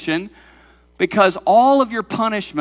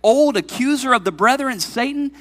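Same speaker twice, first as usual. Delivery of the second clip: raised pitch, pressing rhetorical questions; impassioned preaching; 7 semitones higher, 5 dB louder than usual. Real, not acted. Preacher challenging any accuser of the congregation, impassioned. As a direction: preach it out with conviction, higher and firmer.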